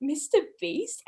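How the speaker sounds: mildly puzzled, rising question. Retold or imitated. Half-asleep woman hears an unknown name, puzzled. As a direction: ask it softly, not knowing the name. Retelling own puzzled reaction.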